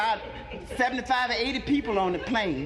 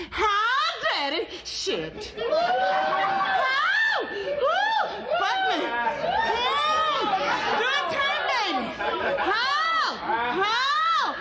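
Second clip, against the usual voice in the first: mock sex screams; 13 semitones higher, much slower than usual. Landlady screaming in bed with the cowboy, hammed-up falsetto shrieks. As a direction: ham up ecstatic screaming in a high voice. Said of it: "They started screaming".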